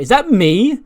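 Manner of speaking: high, loud offended question; shocked disbelief. Retold or imitated. Lyra spotting herself on the suspect board, shocked disbelief. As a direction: squeak it up in startled disbelief.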